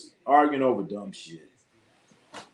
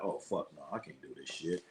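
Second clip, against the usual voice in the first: muttered disgust; 8 semitones lower, 16 dB quieter than usual. Retold or imitated. Retold reaction switching off.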